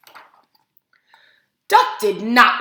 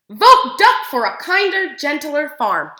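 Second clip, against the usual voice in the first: higher, louder slogan call; campaign slogan; 4 semitones higher, 6 dB louder than usual. A narrator's reading. Reading the duck's campaign poster to kids, loud and high.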